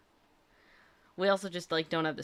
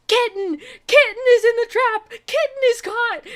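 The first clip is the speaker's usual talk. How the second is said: high, loud excited shouting; all caps excitement; 13 dB louder than usual. Retold or imitated. Mom shouting the cat news to her daughter, high excited yell. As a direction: yell the news in a burst of joy. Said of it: "messages in all caps".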